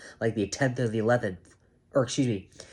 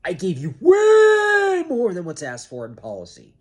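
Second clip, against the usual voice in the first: loud, higher angry yell; angry shout; 8 semitones higher, 9 dB louder than usual. Real, not acted. Venting at the scheduler, shouting angrily. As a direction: shout it out in frustration.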